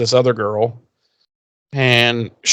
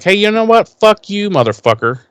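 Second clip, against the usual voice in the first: a higher, pretend tell-off, half-joking; mock defiant comeback; 8 semitones higher than usual. Imagined Buffy telling Parker off, mock defiant. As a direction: fire back at him like you finally snapped, but playful.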